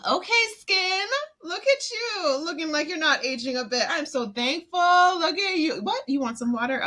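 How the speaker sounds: high, sweet voice cooing at her skin; talking to my skin. Retold or imitated. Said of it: "So I'll literally be like".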